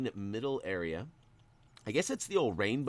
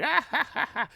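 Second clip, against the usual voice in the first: high cackling mock laugh, louder; imitating wife's laugh; 5 dB louder than usual. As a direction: ham up the wife's giggle. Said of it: "she's like".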